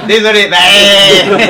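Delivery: real loud laugh through the words; can't stop laughing while apologising. Real, not acted. Laughing at his own rule.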